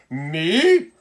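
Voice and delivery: high, loud, slow laughing indignation; outraged disbelief. Acted. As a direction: squeal at the absurd idea. Emperor outraged at bloomers offer.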